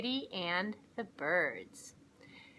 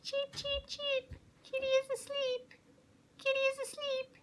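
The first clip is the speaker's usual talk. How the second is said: much higher, tiny bird voice; chirping birds; slower than usual. Acted. Birds chirping gleefully that kitty is asleep, tiny and high.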